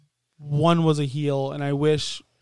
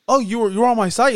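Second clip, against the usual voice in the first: surprised; 6 semitones higher, 4 dB louder, faster than usual. Retold or imitated. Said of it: "so he's like".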